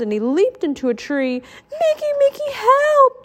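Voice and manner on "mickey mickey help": very high cry for help; high, desperate calling; panicked shout. A narrator's reading. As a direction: squeal for rescue from up a tree. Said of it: "Donald shouted".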